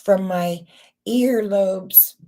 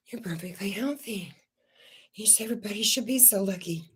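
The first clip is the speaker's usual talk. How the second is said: mock whisper; 7 dB quieter, faster than usual. Mocking what doctors tell her.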